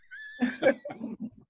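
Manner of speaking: a quick high airless laugh; real laugh. Real, not acted. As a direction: laugh at yourself, high and breathy.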